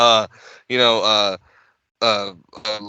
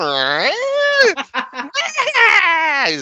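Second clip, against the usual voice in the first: high cackle. Retold or imitated. Imitating Crypt Keeper laugh.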